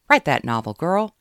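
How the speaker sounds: put-on loud cheer rising high on girl. Caroline cheering Kate to write her novel. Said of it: "Caroline cheered".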